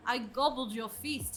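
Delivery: normal talking voice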